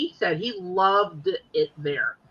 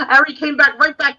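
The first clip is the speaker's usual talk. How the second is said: louder, laughing through the words; 6 semitones higher, 8 dB louder than usual. Real, not acted. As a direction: laugh it out, amused. Laughing at the Amtrak story.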